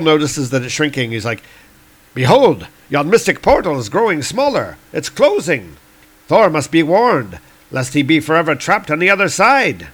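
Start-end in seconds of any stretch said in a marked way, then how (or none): 2.1-9.9 higher, urgent swashbuckler declamation; alarmed Fandral. Acted. Warrior seeing the portal shrink, urgent alarm. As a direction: cry the warning in grand old-fashioned style.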